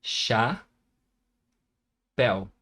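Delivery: "Chá, pel" as two isolated syllables, long pause between. Shown as a demonstration. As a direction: break it cleanly into "Chá" and "pel". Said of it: "Vamos separar".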